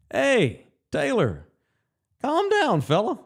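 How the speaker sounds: high-pitched imitation of an Arkansas twang. Manager telling a hot-headed player to settle down, in an Arkansas twang. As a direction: imitate an old ballplayer's Arkansas drawl. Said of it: "In that Arkansas twang".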